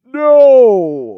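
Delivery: huge, high, loud booming God; booming voice from sky. Acted. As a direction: boom it down from the heavens. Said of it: "That's your God voice?".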